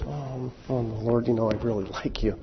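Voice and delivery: soft, hesitant reply; sheepish Peter. Acted. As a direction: shrink back and mumble it out.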